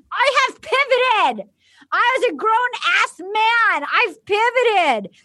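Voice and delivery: high, slow, emphatic proclamation; hammed-up wounded pride; mock indignant declaration. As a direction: stretch it out, pitched high. Acting out an indignant quarrel line.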